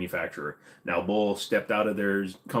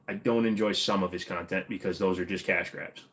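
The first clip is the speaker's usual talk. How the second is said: usual speaking voice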